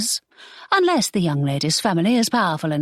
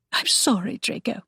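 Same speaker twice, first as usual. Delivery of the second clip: soft, breaking apology; broken whisper; 5 semitones higher, 4 dB quieter than usual. Acted. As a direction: whisper it as if about to cry. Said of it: "she whispered brokenly".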